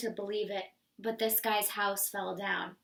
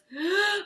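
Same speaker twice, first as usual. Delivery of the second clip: a high panting gasp; huffing gasp; 7 semitones higher than usual. Acted. Wolf panting while breaking the door. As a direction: gasp for breath mid-huff.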